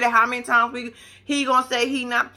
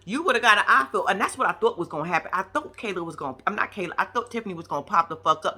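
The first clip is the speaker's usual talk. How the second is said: ordinary talk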